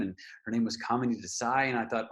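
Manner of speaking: ordinary delivery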